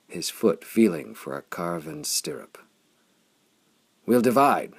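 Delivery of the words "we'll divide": loud, high call over the fighting; yell. A narrator's reading. Flame yelling a plan to the Mornalian rider. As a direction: shout the plan across the chaos. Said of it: "Flame yelled to the Mormalian".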